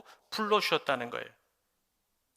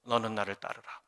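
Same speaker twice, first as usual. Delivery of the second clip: gentle command; 8 semitones lower, 8 dB quieter than usual. Acted. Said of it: "그리고 뭘 주세요?".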